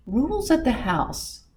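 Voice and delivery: plain reading voice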